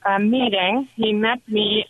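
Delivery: usual speaking voice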